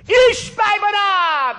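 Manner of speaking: long high shouted name; calling out. Acted. Abishai challenging the giant from behind, a shout. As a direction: call him out from behind.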